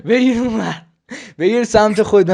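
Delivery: loud insistent order; urgent call. Real, not acted. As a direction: shout to get him to turn the camera. Telling him to turn camera.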